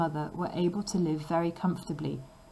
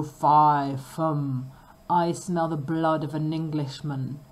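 loud booming giant, slowing at the end; roaring ogre; 2 semitones lower, 5 dB louder, slower than usual. Acted. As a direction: roar it out like a hungry ogre. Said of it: "sniffed round the kitchen and roared".

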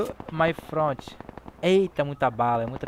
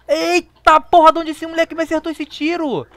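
much higher, louder startled shout; shocked yell; 12 semitones higher, 13 dB louder than usual. Real, not acted. Shot by surprise.

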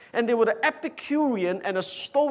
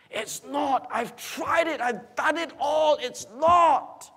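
insistent, high-pitched preacher's outcry; emphatic testimony; 9 semitones higher, slower than usual. Acted. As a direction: cry out a warning from experience, rising in force. Preacher voices Solomon's warning against pleasure.

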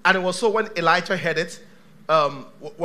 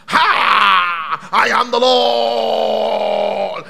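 very loud, hugely drawn-out proclamation; dramatic booming God voice; 10 dB louder, much slower than usual. Acted. Preacher mocking the voice people want from God, booming and theatrical. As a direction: thunder it out, stretching the words enormously. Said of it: "that kind of voice".

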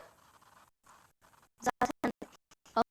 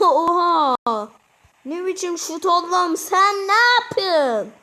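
much louder and higher, slow outraged yell; shouting; 5 semitones higher, 9 dB louder, slower than usual. Real, not acted. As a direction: yell at the player in disbelief. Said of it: "Biraz fazla bağırıyorum galiba". (oyuncuya yüksek sesle bağırarak)

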